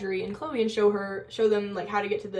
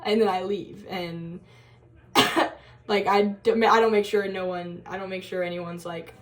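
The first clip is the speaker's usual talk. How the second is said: normal talking voice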